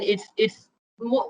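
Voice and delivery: ordinary talk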